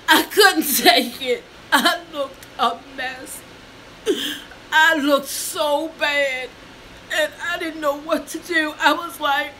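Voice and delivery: breaking down. Real, not acted. To viewers, crying as she relives seeing the mirror. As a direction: let the tears break through. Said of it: "I'm sorry if I break down".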